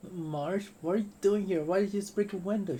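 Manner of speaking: high, loud astonished questions; startled friend. Acted. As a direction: gasp at a friend breaking a window. Excited surprised friend.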